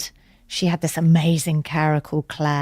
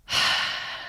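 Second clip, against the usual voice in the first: soft breathy sigh before agreeing; mock sigh; 5 dB quieter than usual. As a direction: let out a resigned sigh. She reacts to the request.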